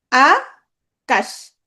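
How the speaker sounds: আকাশ as two separate beats, আ held, then কাশ; split into syllables. Shown as a demonstration. Teacher showing students the syllables of Akash, split slowly in two. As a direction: break Akash after a drawn-out আ. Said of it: "এক টানে বা এক ঝোঁকে".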